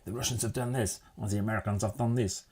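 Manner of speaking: put-on soft, half-hearted Russian accent; terrible Russian accent. Imagining a space race team member talking, weak Russian accent. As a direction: try a bad Russian accent, quietly. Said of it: "That was my terrible Russian accent".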